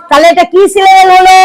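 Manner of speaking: ordinary delivery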